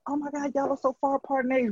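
gossipy amazement, rattled off fast; mock astonishment. People reacting to siblings' age gap, mock astonished.

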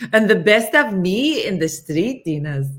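loud, lifted imitation of a friend; Jurgen impression. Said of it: "That was Jurgen".

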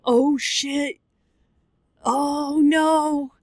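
high, stricken mock wail; dismayed dread. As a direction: cry out as the bad news lands. Retold dawning dread of dog's death.

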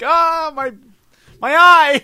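high, loud mock cry of pain; high pained yelp. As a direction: shriek like you got hit in the eye. Acted victim cries out.

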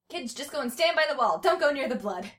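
mild narrated yell. A narrator's reading. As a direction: call out an urgent order to kids. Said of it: "Karen yelled at as she carried".

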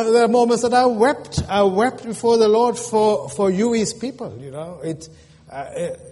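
heartfelt and earnest; sincere. Real, not acted. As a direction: pour out a pastor's heart. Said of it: "I say it sincerely and honestly".

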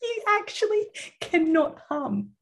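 held-nose attempt to hum; squeezed laugh through a pinched nose; pinching nose. Shown as a demonstration. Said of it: "This is my noise when holding my nose".

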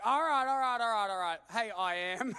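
grudging Moses. Acted. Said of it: "Moses is like".

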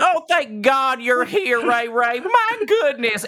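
high, desperate small-town sheriff; frantic sheriff. Acted. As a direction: plead for rescue, high and pleading. Rescued sheriff voiced by GM.

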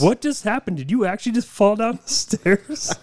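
high, loud alarmed question; startled wife calling out; surprised. Retold or imitated. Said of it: "I heard Sarah".

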